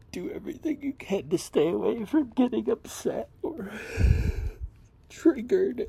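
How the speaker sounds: fighting to hold it together while telling it; real distress. Real, not acted. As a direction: voice strained high and loud with upset. Tells a painful upsetting fight.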